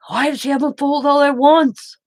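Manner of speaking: mock the disbelieving dad; incredulous dad. Dad's incredulous question retold.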